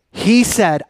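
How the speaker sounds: usual speaking voice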